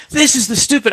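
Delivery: high, indignant retold rant; high mock tirade, cut short; mad cursing imitation. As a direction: act out someone cursing in your face. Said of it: "he was cursing like mad".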